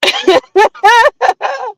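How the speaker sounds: squealing high laugh. Real, not acted. Big laugh at the chat.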